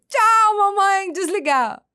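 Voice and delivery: put-on high, loud toddler goodbye. Mother imitating her son ending the call. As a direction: play the little boy hanging up on mom.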